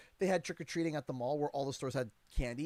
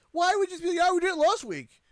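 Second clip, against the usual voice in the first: incredulous mockery; 11 semitones higher, 8 dB louder, faster than usual. Mocking the staff's answer.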